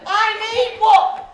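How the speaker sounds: high, loud, drawn-out demand; urgent shout. A narrator's reading. Mary begs the onion lady as the audience answers, urgent shout. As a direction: cry out slowly, cueing a crowd.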